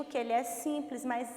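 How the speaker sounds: ordinary delivery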